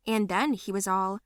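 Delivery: usual speaking voice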